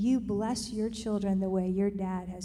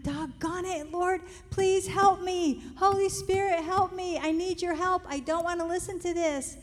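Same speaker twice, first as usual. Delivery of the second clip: a mother's urgent plea to the Holy Spirit, raised and slowed; pleading prayer; 7 semitones higher than usual. Retold or imitated. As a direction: cry out to God for help, half in tears. Desperate prayer in the moment.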